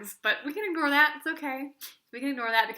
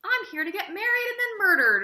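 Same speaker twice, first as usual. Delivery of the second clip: mocking airhead quote; 9 semitones higher than usual. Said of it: "the only woman in the entire book is basically like".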